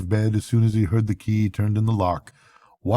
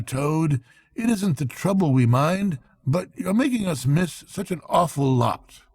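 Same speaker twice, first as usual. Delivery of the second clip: lightly raised, brisk and hearty friend; Rat's brisk cheery voice; 9 semitones higher than usual. A narrator's reading. Rat rousing Toad from bed, hearty and brisk. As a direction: coax a sulking friend out of bed.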